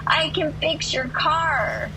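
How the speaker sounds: high, slow call between cars. Retold or imitated. Stranger offers repairs to a driver while driving, calling out.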